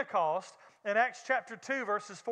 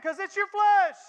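louder, higher preacher's punch; emphatic punchline; 8 semitones higher, 7 dB louder than usual. Real, not acted. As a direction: land the point hard. Punchline of the sermon point.

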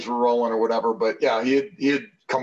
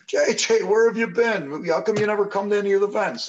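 excited greeting; 9 semitones higher than usual. Retold or imitated. Old teammate greeting him eagerly.

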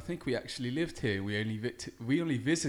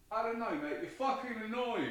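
high-pitched British character reply; Trevor answering; 8 semitones higher, 3 dB quieter than usual. Acted. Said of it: "Trevor, what you think about me".

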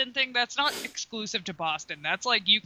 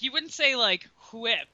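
ordinary delivery

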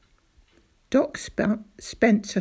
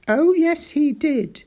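loud, higher insistence; emphatic insistence; 7 semitones higher, 6 dB louder than usual. Acted. Doctor insists to father, loud and emphatic. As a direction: hammer it home.

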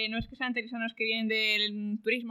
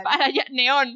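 loud command; 3 semitones higher, 8 dB louder than usual. Acted. GM voices figure pointing.

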